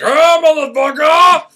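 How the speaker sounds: loud, slow, high bellow; angry shout. Retold or imitated. Fake police officer bursts in on Candide, loud angry shout. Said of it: "this fake fucking police officer busts in".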